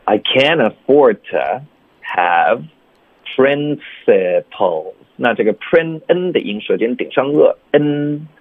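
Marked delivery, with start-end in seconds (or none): 0.1-4.9 slow I can afford to with to reduced. Shown as a demonstration. Teacher modelling the whole linked line. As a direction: drag principles out slowly. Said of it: "弱读成th".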